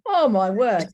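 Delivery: real excitement, loud and bright; delighted gasp. Real, not acted. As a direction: gush over the treat. Presenter sees cream-egg donuts.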